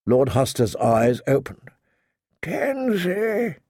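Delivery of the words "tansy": high, weak sick man's husk; husky with pain. A narrator's reading. Dying lord calls for an unknown woman, husky and pained. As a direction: strain the name out through pain. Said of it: "he husked, in a voice thick with pain".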